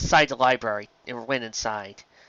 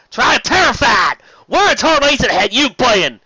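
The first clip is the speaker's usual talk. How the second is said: exasperated shout; 12 semitones higher, 10 dB louder than usual. A narrator's reading. Applejack yelling at her little sister for running off. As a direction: yell it with relief turned to anger.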